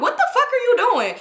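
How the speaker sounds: high-pitched, laughing disbelief; a shrill mock yell of shock, half laughing; shocked outburst. Mom shocked at man grabbing her.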